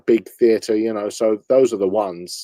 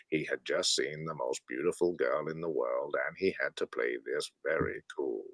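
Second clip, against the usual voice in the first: much lower, softer documentary narrator; deep hushed film narrator; Morgan Freeman narration; 9 semitones lower, 15 dB quieter than usual. Acted. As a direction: narrate your own flirting like a wildlife film. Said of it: "So I'm narrating".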